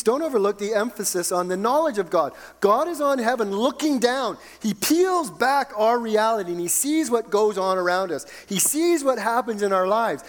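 high, emphatic pulpit exhortation; fervent preaching. Real, not acted. Preaches at full force.

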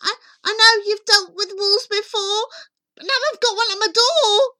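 high, stammering, hurried plea; frightened pig. Acted. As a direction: blurt it out in piggy panic.

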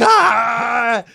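loud, high mock wail; imitated baby scream. Dad mimicking newborn tugging her hair, loud wail. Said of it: "and she's like".

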